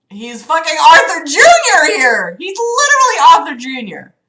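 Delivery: loud, high New York outburst; New York accent. Real, not acted. Reader recognizing Arthur Junior, loud New York accent. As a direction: exclaim it like an indignant New Yorker. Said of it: "I said that real fucking New York".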